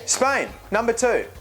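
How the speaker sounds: high, slow, emphatic announcement; playfully defiant, pitched high; insistent repeat. Pretend. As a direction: repeat it firmly for the doubters.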